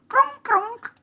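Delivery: high, loud crunching noise; munching imitation. Boy mimicking rabbit eating carrot, high crunching. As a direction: crunch it out like cartoon chewing. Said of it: "mangiava la carota esclamò".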